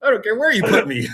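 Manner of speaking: don't care at all, laugh it out; new hire laughing he'd happily sit in a closet; laughing eager reply. Retold or imitated. Eager to take any desk.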